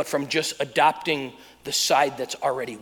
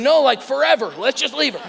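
high, loud comic insistence; mock exasperation; 9 semitones higher, 6 dB louder than usual. Dad arguing with wife over leaving daughter behind, comic exasperation. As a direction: insist on it in a raised, fed-up voice.

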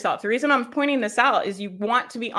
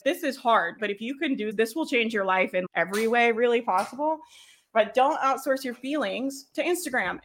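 ordinary talk, nothing marked

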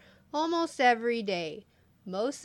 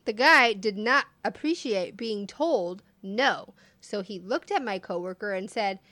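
ordinary talk, nothing marked

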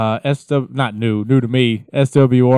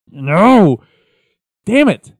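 a sudden, pitched-up "No! Damn it!"; frustrated outburst; 7 semitones higher, 6 dB louder, much slower than usual. Retold or imitated. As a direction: blurt it like a let-down fan. Frustrated listener voiced mid-song.

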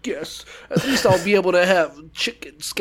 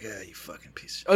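mumbled insult, much quieter; muttered under breath; 10 semitones lower, 12 dB quieter than usual. Retold or imitated. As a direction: grumble it under your breath. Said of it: "he's like".

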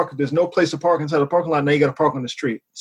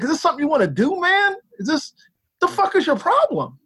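high, exasperated challenge; retold confrontation; 9 semitones higher than usual. Driver challenging the dog walker to a fight, acted irritation. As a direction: act out squaring up to someone, pitch climbing.

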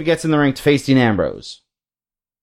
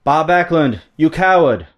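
higher-pitched, badly faked Iron Sheik accent; terrible Iron Sheik accent; 4 semitones higher, slower than usual. Iron Sheik confronts Backlund on the mic, badly faked Sheik accent. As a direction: call him out in a bad Sheik accent. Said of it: "That's a terrible Sheik accent".

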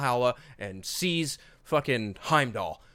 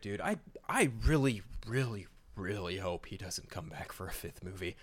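normal talking voice

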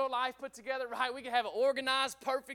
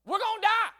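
high-pitched, incredulous mock protest; panicky disciples; 7 semitones higher than usual. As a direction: whine up high like a scared follower doubting the plan. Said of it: "the disciples are like".

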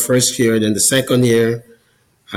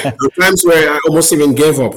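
louder and higher, laughing through it; 5 semitones higher, 5 dB louder, faster than usual. Real, not acted. Guest laughs admitting he nearly gave up, louder and higher. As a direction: laugh ruefully at nearly quitting.

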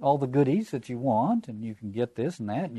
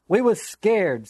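higher, louder and slowed for weight; excited; 8 semitones higher, 6 dB louder, slower than usual. Acted. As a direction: insist it, worked up and emphatic. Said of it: "said Piggy excitedly".

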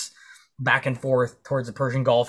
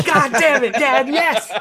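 much higher and louder, laughing with joy; overjoyed yelp; 13 semitones higher, 8 dB louder, slower than usual. Retold or imitated. Son to dad after the surprise gun, laughing with delight. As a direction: squeal like a kid at Christmas.

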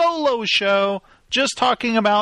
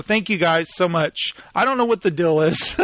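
ordinary talk

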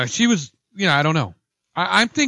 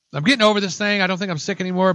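ordinary talk, nothing marked

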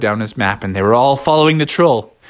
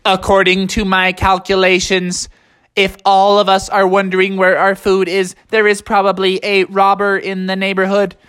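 robotic monotone, pitched up; high, level robot voice; Techno Bear; 7 semitones higher than usual. Acted. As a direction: recite it evenly like a computer. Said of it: "that's when Techno Bear said".

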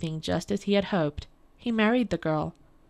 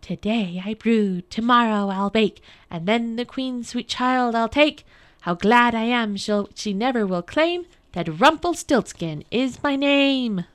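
rhythmic chanted rhyme, rising and louder; gleeful crying out; 4 semitones higher, 5 dB louder than usual. A narrator's reading. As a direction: crow it out like a secret boast. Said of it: "hopping on one leg and crying".

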